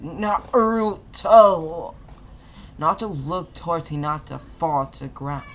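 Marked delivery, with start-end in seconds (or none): 0.0-1.9 long drawn out loud yell, slow; yelled name. A narrator's reading. Stabbed Hinata crying out to Naruto, long loud yell. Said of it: "she yelled out".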